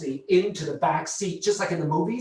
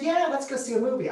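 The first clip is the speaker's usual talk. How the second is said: imitating Kelly; 9 semitones higher, faster than usual. Kelly voiced in retold story.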